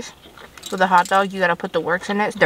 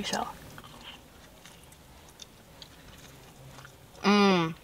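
muffled words between bites; talking while chewing; slower than usual. Real, not acted. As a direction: mouth full of pizza. Seen 0: Bites at -4, chews with full mouth, then talks holding slice.

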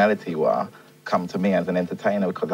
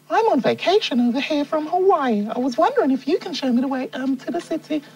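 very high put-on woman's voice; lady from Hawaii. Lady tourist asking a local man for directions, high woman's voice. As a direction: play a female tourist in high falsetto. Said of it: "sexy lady. She said to me".

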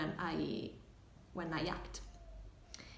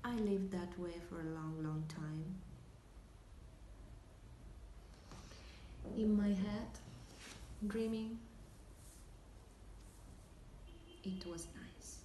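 halting, with long dreamy pauses between phrases; hushed, lingering stage confession; wistful monologue; 3 semitones higher, 9 dB quieter than usual. Acted. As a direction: drift through the daydream, letting it fade softly at the end.

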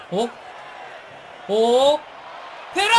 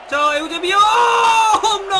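much higher, loud and stretched out; mock the shot into the sky; excited yell; 10 semitones higher, 8 dB louder, slower than usual. Real, not acted. Shot over the bar, yelling. (홈런 슛에 높게 늘어진 외침)

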